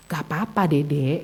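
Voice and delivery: low, soft, slow reassurance; comforting parent. Retold or imitated. Mother consoles child who missed the trophy, soft reassurance. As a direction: soothe a small child gently and slowly. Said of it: "Saya berusaha untuk membesarkan, eh, apa, hatinya".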